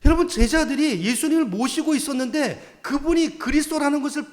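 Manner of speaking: impassioned pulpit preaching; preaching at full force. Acted. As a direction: hammer each short line home. Preacher builds to climax.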